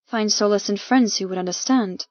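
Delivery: plain reading voice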